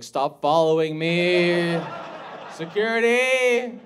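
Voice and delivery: slow, drawn-out loud cry for help; mock alarmed yell. Woman being followed calls for security, mock alarmed yell. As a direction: call out loudly, rising in pitch. Fan yelling at pursuer.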